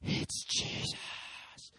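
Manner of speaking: very slow, breathy, weeping whisper; broken, hushed sobbing; tearful whisper. Retold or imitated. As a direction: whisper the name through tears. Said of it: "And he just cries".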